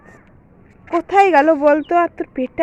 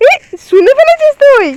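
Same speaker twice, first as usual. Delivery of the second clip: loud, high startled exclamation; startled at being overheard; 8 semitones higher, 6 dB louder than usual. Acted. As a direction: jump at being caught out, voice shooting up. Caught plotting, child overheard.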